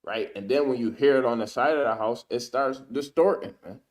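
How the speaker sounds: normal talking voice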